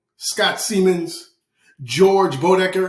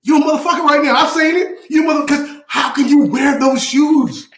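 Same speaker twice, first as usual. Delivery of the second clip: high-pitched, indignant shouting; worked-up comic tirade, voice way up; passionate rant; 8 semitones higher than usual. Real, not acted. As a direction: let it rip, outraged and incredulous. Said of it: "I just have to be passionate".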